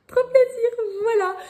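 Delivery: high, loud burst of delight; over the moon. Real, not acted. Thrilled about her new bookmarks, bursting with delight. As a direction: gush with real joy. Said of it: "je suis trop contente".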